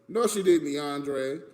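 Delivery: ordinary talk, nothing marked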